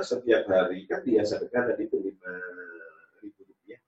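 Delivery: ordinary talk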